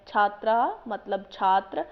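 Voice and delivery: ordinary delivery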